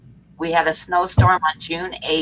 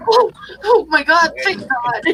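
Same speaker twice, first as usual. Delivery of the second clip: relieved excitement; 11 semitones higher, 3 dB louder than usual. Retold or imitated. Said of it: "And I was like".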